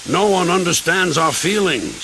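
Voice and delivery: high, plaintive commiseration; sympathizing. A narrator's reading. Gordon commiserates with Sir Handel, sympathizing. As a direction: commiserate with a fellow grumbler. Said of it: "sympathized Gordon".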